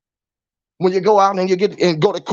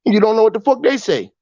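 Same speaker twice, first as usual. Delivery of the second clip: ordinary delivery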